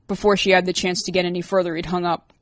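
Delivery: plain reading voice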